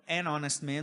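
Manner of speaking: "An honest man" sem som de H; H mudo. Shown as a demonstration. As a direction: diga "An honest man" começando pelo som do O. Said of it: "aqui o H não tem som". (honest com H mudo)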